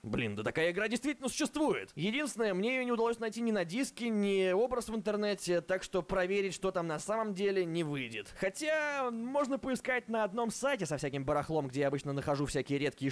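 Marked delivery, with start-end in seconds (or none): none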